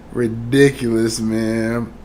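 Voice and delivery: ordinary talk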